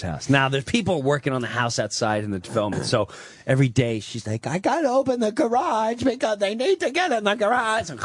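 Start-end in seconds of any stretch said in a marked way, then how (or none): 4.5-7.9 mother's excuse. Retold or imitated. Mother voiced in retold story.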